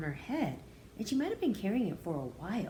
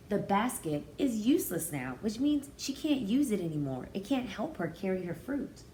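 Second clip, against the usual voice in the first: ordinary delivery, nothing marked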